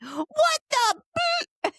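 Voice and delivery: high squeaky mock outrage; high mock-baffled squeak; husband's baffled cry. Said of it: "he's like".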